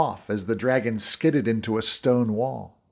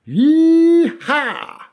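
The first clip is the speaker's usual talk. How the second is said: high, loud whooping yee-haw; cowboy yell; 14 semitones higher, 7 dB louder than usual. A narrator's reading.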